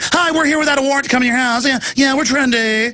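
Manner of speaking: high, fast mock-trendy TV host; put-on high trendy chirp. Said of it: "in the trendy voice".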